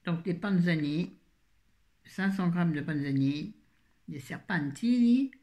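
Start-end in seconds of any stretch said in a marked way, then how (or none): 4.1-5.3 put-on higher, playful Italian accent; mock Italian accent. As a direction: say it with a hammed-up Italian lilt. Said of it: "Oh, l'accent italien". (faux accent italien chantant)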